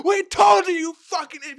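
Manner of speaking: high shouted scolding; mock yell. Onlooker yelling at the tossed woman, mock yell. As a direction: yell it at the fool. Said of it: "It's like".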